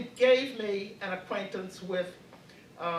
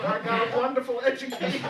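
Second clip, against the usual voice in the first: louder, laughing through a proud punchline; 3 semitones higher, 6 dB louder, faster than usual. Real, not acted. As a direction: say it with a laugh rising in your voice. Proud punchline about her schooling.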